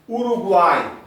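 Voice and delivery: Uruguai said high and loud, uai in one syllable; loud example word. Shown as a demonstration. Teacher to class, showing tritongo with loud Uruguai. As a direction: call out Uruguai with the gliding uai.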